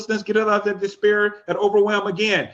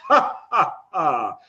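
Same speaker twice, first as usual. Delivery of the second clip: hearty laugh; 3 semitones lower, much slower than usual. Shown as a demonstration. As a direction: show them a hearty laugh on cue. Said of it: "That's my hearty laugh".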